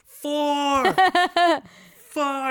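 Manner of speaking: high, mocking count-off; squeaky audience counting along; mock counting crowd. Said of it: "they go".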